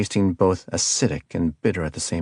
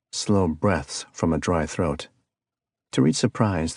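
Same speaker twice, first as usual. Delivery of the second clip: usual speaking voice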